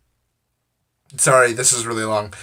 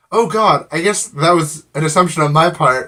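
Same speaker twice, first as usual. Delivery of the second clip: higher, louder mock dismay; an imitated "oh God" moment of being caught out; retold flustered realization; 5 semitones higher, 6 dB louder than usual. Woman admits her assumption to passenger, flustered.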